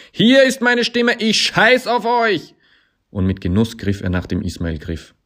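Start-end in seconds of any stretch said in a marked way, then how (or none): 0.1-2.5 slow, very high all-out yell; full scream. A narrator's reading. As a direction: scream every word out defiantly. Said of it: "schrie er der Kletterhalle entgegen".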